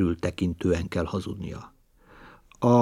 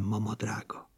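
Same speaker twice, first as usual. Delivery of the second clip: spiteful whisper; 12 dB quieter than usual. Acted. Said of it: "kajánul ezt sukta".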